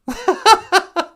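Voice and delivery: shrill burst of laughter, way up; squealing giggle; high laugh. Real, not acted. Laughing at own joke.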